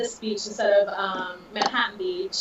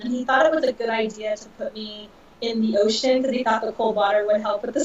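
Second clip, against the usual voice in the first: normal talking voice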